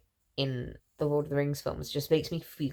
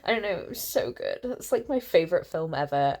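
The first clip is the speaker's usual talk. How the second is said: ordinary delivery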